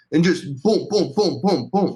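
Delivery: loud high booming imitation of sewing machines; mock machine booms. Neighbor's sewing machines mimicked with loud booms. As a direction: boom it out like a machine pounding.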